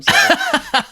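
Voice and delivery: very high, loud laughing squeal; squealing through laughter; laughing apology. Real, not acted. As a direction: break character with a giggle. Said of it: "His accent is changing".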